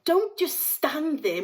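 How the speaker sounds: wife screaming at her husband to do something; screamed order. A narrator's reading. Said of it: "Missus Gloop screamed".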